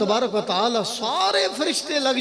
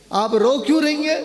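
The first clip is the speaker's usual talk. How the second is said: higher and louder, urging her to answer; a raised, insistent plea to speak; pressing question; 7 semitones higher, 4 dB louder than usual. Retold or imitated. The cleric presses the weeping widow again, higher and louder.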